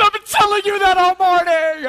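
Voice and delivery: high, loud told-you-so; loud, high vindicated yell; triumphant shout. Retold or imitated. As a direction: blast it out, finally proven right.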